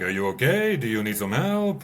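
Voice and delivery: lightly put-on French accent asking if you're okay; a quick French-accented check-in over the bike speaker; mock French accent. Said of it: "in some French accent".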